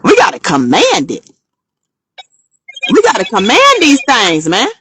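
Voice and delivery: loud, forceful exhortation; commanding conviction. Shown as a demonstration. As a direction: punch it out like an order, at full voice. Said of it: "we gotta speak it with conviction".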